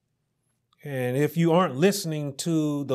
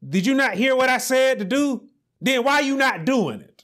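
scolding parent; 8 semitones higher than usual. Retold or imitated. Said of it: "they would come and say".

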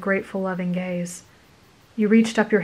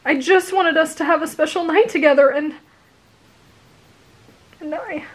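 voice breaking; 8 semitones higher than usual. Acted. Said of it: "his voice broke".

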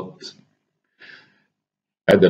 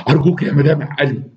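mild mock yell; 7 semitones higher than usual. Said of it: "صرخت فيها".

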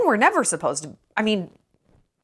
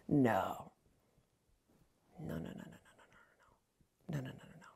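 gefluisterde reeks nee's; whispered no; 10 semitones lower, 20 dB quieter than usual. Real, not acted. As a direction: fluister het herhaald afwijzend. Rejecting in whisper.

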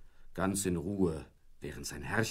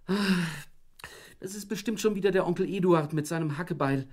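high, fast harried complaint; a mild mock groan, rattled off high; wife grumbling about the phone call; 9 semitones higher, 3 dB louder than usual. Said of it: "stöhnte Frauchen".

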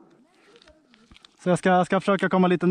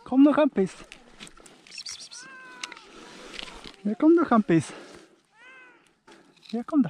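calling to a cat; 10 semitones higher than usual. Real, not acted. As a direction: sweet-talk the cat down from the branch. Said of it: "Jag pratar med katten". (ljust och högt lockande på katten)

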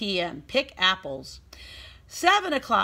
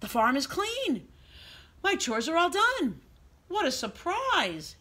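delighted surprise; 8 semitones higher than usual. A narrator's reading. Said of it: "he cried".

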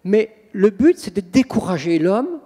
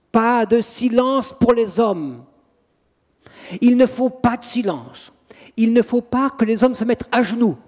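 higher, insistent mock howl of protest; imitated demon's raised-voice refusal; retold demonic yelling; 5 semitones higher, 3 dB louder than usual. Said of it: "elle se met à hurler".

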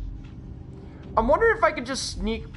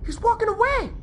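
much higher, squeaky disbelief; excited squeal; 9 semitones higher, faster than usual. Real, not acted. Player watching enemy walk away, excited squeal. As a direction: squeal it in amazement.

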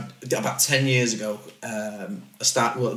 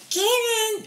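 put-on much higher childlike little-boy voice. Dad calls his baby son in a high little-kid voice. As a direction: call his name in a tiny, high kid's voice. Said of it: "I've always been able to do The Voice".

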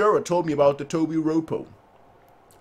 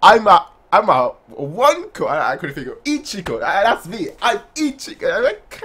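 usual speaking voice

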